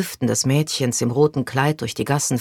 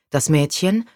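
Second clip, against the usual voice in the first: usual speaking voice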